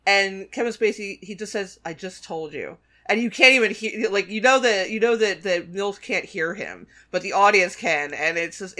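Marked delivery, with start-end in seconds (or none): 1.8-2.8 soft, low, chilling murmur; quiet chilling line. Retold or imitated. Killer coldly answering a frantic detective, quiet. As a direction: deliver it barely audible, icy calm. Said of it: "the most chilling line reading".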